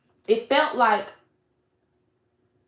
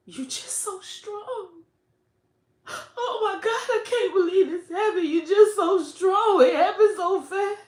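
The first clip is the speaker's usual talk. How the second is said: high, breathy-cracked mock gushing, half crying; high teary imitation of an amazed friend; gushing Flint; 12 semitones higher than usual. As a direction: play a teary, awed friend gushing over strength. Flint gushing, near tears.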